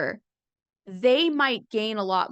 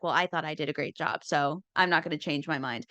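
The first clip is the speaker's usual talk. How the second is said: low, mumbled smug voice; mock the stubborn debater under your breath; lightly voiced self-satisfied opponent; 6 semitones lower than usual.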